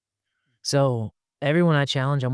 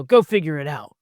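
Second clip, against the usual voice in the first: dismissive order; 3 semitones higher, 5 dB louder than usual. Acted. Acts out the dismissive challenger.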